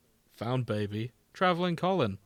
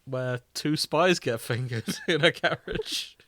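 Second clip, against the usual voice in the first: laughing; 3 semitones higher, 4 dB louder, slower than usual. Real, not acted. Laughing while speaking.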